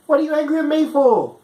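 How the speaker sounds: high, incredulous protest; high-pitched mock indignation; indignant. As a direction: sound wronged and baffled. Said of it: "I'm like".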